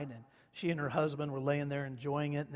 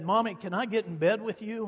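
put-on higher, louder little boy pleading to his mom; little boy asking; 6 semitones higher, 7 dB louder than usual. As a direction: ask sweetly like a small child at the bedside. Little boy asks mother.

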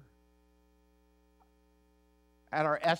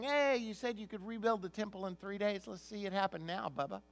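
higher, casual jeering voice; mock taunt; 6 semitones higher, 4 dB quieter than usual. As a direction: tease him like a heckler. Passersby taunting, paraphrased.

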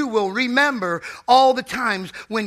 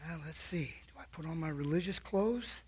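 much softer, low musing to himself; quiet aside; 5 semitones lower, 15 dB quieter than usual. Retold or imitated. Alone by the bed, musing quietly to himself. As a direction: mutter the question under the breath.

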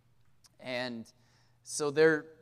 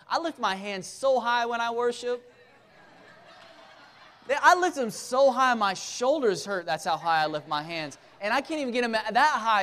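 louder, higher resentful rant; jealous church member; 6 semitones higher, 5 dB louder than usual. Acted. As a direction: play the bitter longtime member complaining out loud. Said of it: "I'm gonna represent the average church person".

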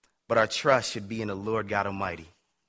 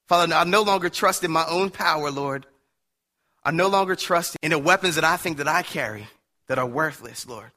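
fervent prayer; 4 semitones higher, 3 dB louder than usual. Acted. Pastor prays aloud to God.

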